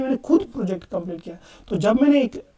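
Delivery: normal talking voice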